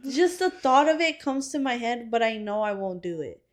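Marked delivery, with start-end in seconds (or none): none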